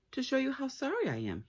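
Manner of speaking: cat apologizing to Diva; sorry cat. Acted. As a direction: plead it sheepishly.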